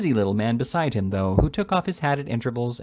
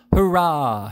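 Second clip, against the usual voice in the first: a crowd's loud cheer, higher; shout; 5 semitones higher, 6 dB louder than usual. A narrator's reading. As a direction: bellow it out like the mob. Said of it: "shouted the mob".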